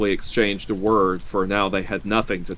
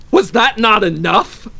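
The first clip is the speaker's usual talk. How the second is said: high, loud, outraged challenge; fierce demand; 13 semitones higher, 9 dB louder than usual. A narrator's reading. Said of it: "he demanded fiercely".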